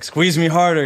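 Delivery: hammed-up eager voice; pushy hug coach. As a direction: imitate the eager guy insisting. Voicing the man demanding hugs.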